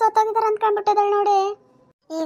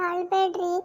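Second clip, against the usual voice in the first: usual speaking voice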